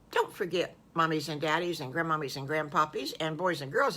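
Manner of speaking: ordinary delivery, nothing marked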